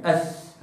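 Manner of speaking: أث with tongue tip out, soft hiss; model th sound. Shown as a demonstration. Teacher to students, model أث with tongue out. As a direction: demonstrate أث slowly with the tongue showing. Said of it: "هكذا".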